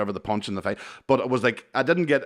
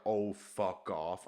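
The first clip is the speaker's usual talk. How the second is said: very slow, softer retold dread; slow-motion reaction; 10 dB quieter, much slower than usual. Watching the punch coming, slow-motion retold reaction. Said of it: "it was actually the slow mo".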